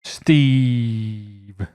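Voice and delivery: "Steve" with one long, stretched-out vowel; drawn-out name. Pretend. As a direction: hold the E in "Steve" low and long. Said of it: "his name with four E's".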